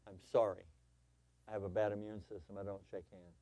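soft, low, apologetic; kind apology. Pretend. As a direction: excuse yourself gently, voice dropping away. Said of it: "But she could have said".

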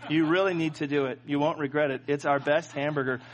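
a hint of a sweet old counter lady; lightly voiced patient old lady. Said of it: "She's saying to me".

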